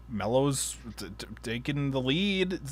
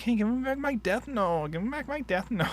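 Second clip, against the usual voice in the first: higher nerd voice, lightly done; a hint of a whiny nerd demanding back; nerdy Shinigami; 7 semitones higher, 5 dB quieter than usual. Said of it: "would sound like a nerd".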